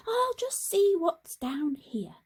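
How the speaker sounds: a curious little frog peeking round. Acted.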